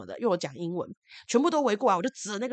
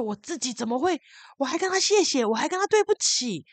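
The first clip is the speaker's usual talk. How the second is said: distressed self-reproach; 7 semitones higher, 4 dB louder than usual. Retold or imitated. Retells self-blame when breaking down.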